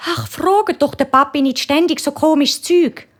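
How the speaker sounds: hohe, mahnende Mutterstimme; Mami. A narrator's reading. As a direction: weise die Kinder hoch zurecht. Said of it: "Da sagt's Mami". (hochi Mami-Stimm)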